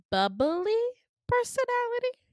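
high, rising pitch on both words; bubbly. Retold or imitated. Describing Jessica's personality to listeners, bubbly. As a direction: lift it way up high.

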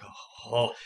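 soft mock gasp of dread; nervous gasp. Game master acting the climber seeing the hole, soft nervous gasp. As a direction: act out a hushed, startled reaction. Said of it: "and you're like".

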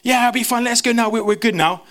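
high, breezy overconfident reassurance; cocky confidence. Retold or imitated. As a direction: brush it off like you've got this. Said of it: "But my confident self".

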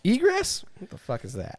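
mock clueless inspector hearing a term he doesn't know; mock clueless surprise. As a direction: act baffled by the word. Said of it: "You're like".

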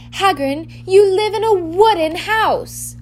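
high, loud, drawn-out exasperation; alarmed protest. Acted. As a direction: object sharply, voice leaping up.

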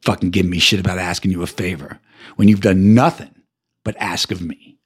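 usual speaking voice

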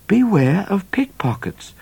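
repeated aloud. Retold or imitated. Midnight repeating the warning aloud to Cat. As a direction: say it out plainly.

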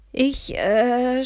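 loud, high, drawn-out hesitation; flustered stammer. A narrator's reading. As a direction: stall on a long "äh". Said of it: "stotterte ich". (verlegen gestottert, laut und gedehnt)

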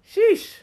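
high loud exhale of sheesh; worn-out sheesh; exhausted sheesh. Retold or imitated. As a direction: blow out a relieved sheesh. Relief at end of day.

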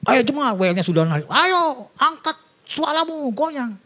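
rally the church like an eager worship leader; hyped worship leader. Retold or imitated. Former worship leader rallying the congregation, hyped and high. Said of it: "saya pernah jadi W L".

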